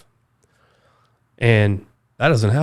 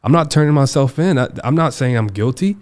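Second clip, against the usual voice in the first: higher, louder retold objection; defiant, raised-voice refusal; indignant protest; 4 semitones higher, 4 dB louder than usual. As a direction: push back in heated disbelief. Retold protest to his attorney.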